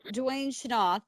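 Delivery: slow "Dwayne Shanoth", surname as shuh-NOTH; family's own pronunciation. Shown as a demonstration. Host resuming the story with the family's way, "Shanoth" as shuh-NOTH. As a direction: say "Shanoth" in two syllables, opening on sh. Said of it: "that's how they pronounced it".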